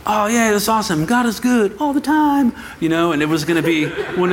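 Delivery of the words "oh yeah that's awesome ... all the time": cheery church crowd. Retold or imitated. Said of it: "people were gonna say".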